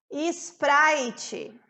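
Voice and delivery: "Sprite" with extra IS in front and TCHI at the end; Portuguese-style SPRITE. Shown as a demonstration. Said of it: "Em português". ("Sprite" com sotaque português, "ispraitchi")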